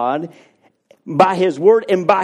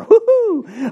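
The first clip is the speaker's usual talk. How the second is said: high falsetto woo-hoo; mock whoop. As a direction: whoop like a sarcastic cheer. Mock cheering at own progress.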